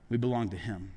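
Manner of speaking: normal talking voice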